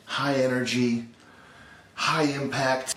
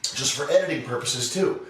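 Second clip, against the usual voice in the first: ordinary delivery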